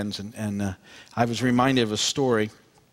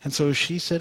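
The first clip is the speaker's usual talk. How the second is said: ordinary talk, nothing marked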